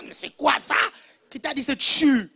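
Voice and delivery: mock outrage. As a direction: challenge them with comic indignation.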